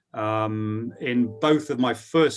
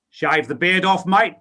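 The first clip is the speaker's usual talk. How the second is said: higher, louder Australian punchline; Australian accent; 8 semitones higher, 8 dB louder than usual. Retold or imitated. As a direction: deliver the boss's blunt Australian verdict. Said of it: "in an Australian accent".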